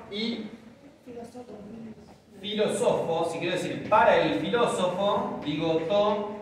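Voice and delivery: ordinary delivery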